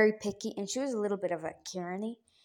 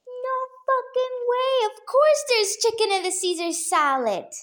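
incredulous outburst; 13 semitones higher, 3 dB louder than usual. Retold or imitated. Mocking outburst at friend.

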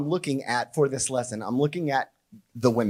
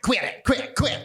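lightly voiced grandma, higher pitch; scolding grandma; 7 semitones higher, slower than usual. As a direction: imitate a grandma telling kids off. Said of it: "then she'd be like".

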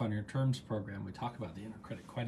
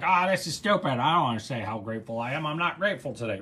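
louder, mock grumbling skeptic; grumpy resistance; 8 dB louder than usual. Host voicing a doubtful viewer, mock grumpy and louder. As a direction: play the reluctant participant who thinks this is stupid. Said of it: "you might be going like".

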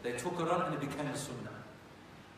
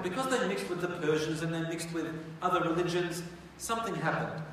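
ordinary talk, nothing marked

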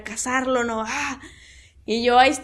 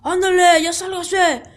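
retold guard yelling; 4 semitones higher than usual. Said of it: "le empieza a gritar".

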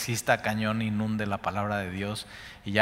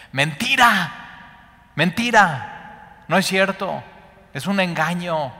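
emphatic denial; 6 semitones higher, 5 dB louder, slower than usual. Acted. Preacher denounces the lie.

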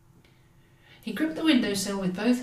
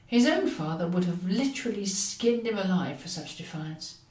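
plain reading voice

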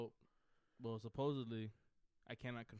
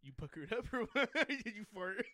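laughing tease; 9 semitones higher, 9 dB louder, slower than usual. Real, not acted. Teasing friend mid story laughing.

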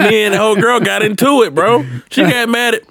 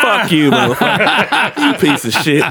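laughing insult. Real, not acted.

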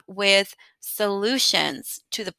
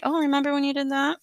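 higher, fast nagging inner voice; anxiety voice; 6 semitones higher, faster than usual. Retold or imitated. Anxious inner voice reminding her of old mistakes, high and quick. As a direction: tease like an anxious voice in your head. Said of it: "crazy anxiety person voice in your head".

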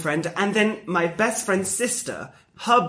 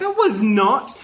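laughing protest; 9 semitones higher, 5 dB louder than usual. Retold or imitated.

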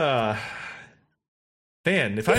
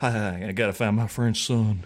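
low, drawling mock film star; Nicolas Cage; 9 semitones lower than usual. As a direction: drop the voice low into a bad impression. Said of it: "always devolves into a cage".

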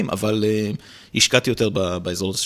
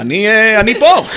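teacher calling out; 9 semitones higher, 9 dB louder, slower than usual. Retold or imitated. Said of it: "מורה שמסתכלת תמיד למעלה".

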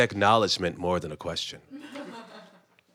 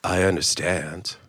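a touch lower, gentle persuasive Yulin; lightly voiced Yulin; 7 semitones lower than usual. Yulin gently pleads for more Christmas love, lightly lowered.

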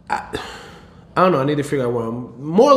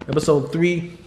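normal talking voice

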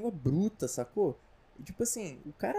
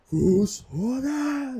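huge drawn-out shout, much higher and louder; Skyrim dragon shout; 9 semitones higher, 9 dB louder, much slower than usual. Retold or imitated. Mother yelling the kid's name like a Skyrim shout, booming. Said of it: "o Frus Rodar lá do Skyrim".

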